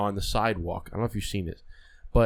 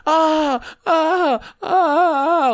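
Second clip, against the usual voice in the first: high, loud pig-like squeals of pain; squealing scream; 9 dB louder, much slower than usual. Retold or imitated. Imitating the man who goes down shot, squealing scream. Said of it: "like a fucking squealing like a pig".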